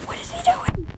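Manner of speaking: high squeaky puppet voice; squeaky character voice. Pretend. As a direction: squeak it like a little doll. Voicing a character.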